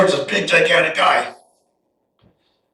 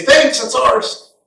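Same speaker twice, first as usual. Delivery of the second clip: smug enemy; 6 semitones higher, much slower than usual. Retold or imitated. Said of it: "take it and say".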